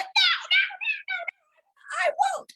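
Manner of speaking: very high laughing, crying-ish no; whiny refusal. Retold or imitated. Jennifer refusing her guides, high whiny laughing protest. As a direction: dig your heels in like a whining child. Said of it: "And you're like".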